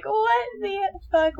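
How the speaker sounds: high, slow, drawn-out disbelief; stunned disbelief. Acted. Speaker acts out viewer's shock, stunned disbelief.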